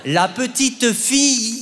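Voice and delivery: drawn out, every sound exaggerated; slow over-articulated dictation. Mother drilling her son on dictation, exaggeratedly slow. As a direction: stretch fille to the bone.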